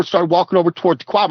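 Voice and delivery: usual speaking voice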